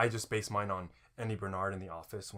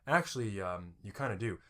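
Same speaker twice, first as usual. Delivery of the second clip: Andy Bernard. Acted. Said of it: "like molasses spilling out of your mouth".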